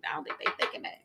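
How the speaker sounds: whispered aside; whispering. Real, not acted. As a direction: drop to a whisper. Quiet aside about [name].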